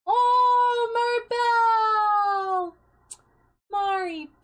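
high, loud, drawn-out mock grief; mock wail. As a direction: wail the name in hammed-up grief. Discovers dead character, wails.

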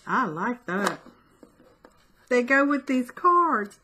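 usual speaking voice

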